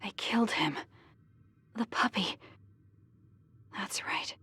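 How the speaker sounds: hushed, grieving whisper; stunned whisper. Acted. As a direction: let the loss sink in quietly. Recalling pet's death.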